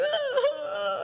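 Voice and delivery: high laugh. Real, not acted. Laugh at own line.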